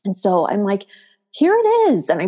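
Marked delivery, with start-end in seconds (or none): 1.3-2.1 exasperated. Retold or imitated. Mom finding her daughter's sleeping bag, exasperated. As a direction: point out the obvious, pitched way up.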